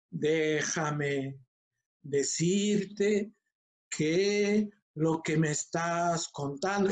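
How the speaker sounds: drawn-out words with long pauses between; exaggeratedly slow. Shown as a demonstration. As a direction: stretch each word out far too calmly. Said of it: "pareciera que te estuvieras burlando".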